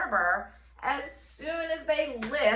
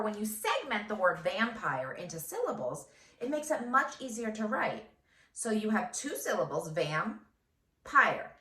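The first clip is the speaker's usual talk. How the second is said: normal talking voice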